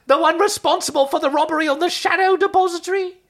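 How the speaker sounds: high, squeaky accusing creature; Dobby. Acted. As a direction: accuse in a loud, high elfin voice. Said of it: "I'm doing Dobby".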